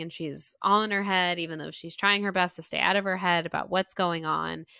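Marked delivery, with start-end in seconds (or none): none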